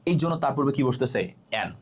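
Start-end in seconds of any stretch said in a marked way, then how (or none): none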